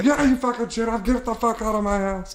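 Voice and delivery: high, mock furious eviction; mock angry. Roommate replaying kicking the guest out, high and angry. Retelling throwing him out.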